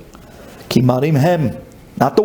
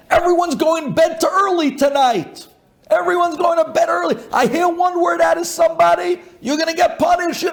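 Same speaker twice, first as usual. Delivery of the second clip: high-pitched retold tirade; yelling at kids. Father threatens his kids with early bed, yelling. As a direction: rail at them in a strained, raised voice. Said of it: "he starts yelling at the kids".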